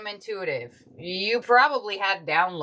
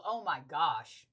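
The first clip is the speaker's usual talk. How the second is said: awed hush; 4 semitones lower, 10 dB quieter than usual. Real, not acted. Awed at card twelve.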